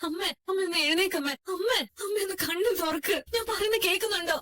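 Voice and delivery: high, crying appeals to mother; tearful pleading. Acted. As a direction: desperately beg her to wake, pitch rising. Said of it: "ശ്രുതി, കരയാതെ മോളെ". (കരഞ്ഞുകൊണ്ട് അപേക്ഷിക്കുന്നു)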